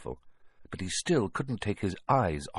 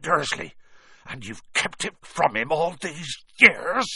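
trembling with rage; 10 semitones higher, 4 dB louder than usual. A narrator's reading. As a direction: let rage build through every syllable. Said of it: "every syllable trembled with rage".

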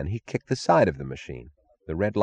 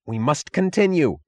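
higher, urgent outcry; frantic cry; 8 semitones higher than usual. Acted. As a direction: cry it out desperately. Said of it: "cried Taggart".

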